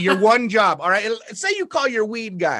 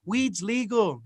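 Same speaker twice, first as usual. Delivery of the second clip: mock cheer; 4 semitones higher, 4 dB quieter, much slower than usual. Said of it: "Everybody's like".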